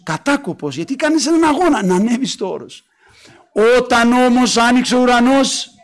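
ordinary talk, nothing marked

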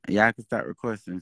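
ordinary talk, nothing marked

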